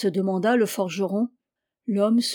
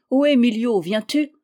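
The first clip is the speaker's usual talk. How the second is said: louder hail to a friend; raised-voice storybook hail; calling out; 3 semitones higher, 5 dB louder than usual. A narrator's reading. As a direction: call out to someone as you pass.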